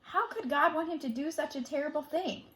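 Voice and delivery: ordinary delivery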